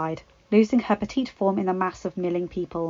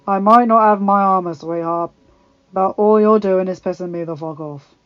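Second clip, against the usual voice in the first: loud gruff grumbling threat; gruff, dry Hound; 7 dB louder than usual. A narrator's reading. The Hound to Arya pounding his back, gruff. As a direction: dryly warn her in a gruff voice. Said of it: "the hound chuckled dryly".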